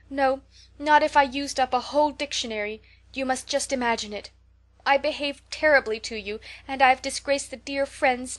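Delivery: high, loud, tearful pleading; beseeching apology. Acted. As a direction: beg forgiveness with everything you have. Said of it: "with a quiver in her voice".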